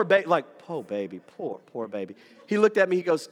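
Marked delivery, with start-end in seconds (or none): 0.6-2.2 put-on low, soft poor-baby voice; mock pity. Mechanic pitying the driver, low soft mock pity. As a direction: coo down at him with pity. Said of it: "the poor baby face".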